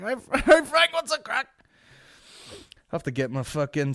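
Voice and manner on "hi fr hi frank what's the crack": very high, loud, fake-cheery hello; mock cheery greeting. Comedian greeting Frank Skinner, very high fake cheer. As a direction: gush awkwardly at the star. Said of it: "I was like".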